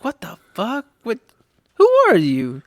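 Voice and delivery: mock bewilderment at baby babble; baffled reaction. Dad reacting to his babbling baby, baffled. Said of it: "I'm like".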